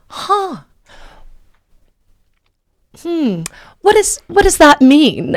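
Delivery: high, puzzled hums, then a question; baffled reaction. Retold or imitated. Man hearing about her podcast, imitated as high and baffled. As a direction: imitate someone baffled, with long pauses.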